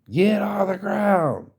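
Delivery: high, slow, bellowed police command; drawn-out shouted arrest command, high and strained; cop screaming. Retold or imitated. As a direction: scream the order like a cop with a rifle aimed. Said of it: "screams at the top of his lung".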